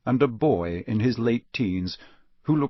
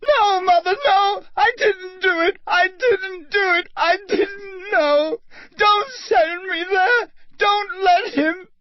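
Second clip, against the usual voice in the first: very high sobbing scream, slowing; screaming and crying; 4 dB louder than usual. Acted. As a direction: scream and sob in panic. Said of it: "The boy began to scream".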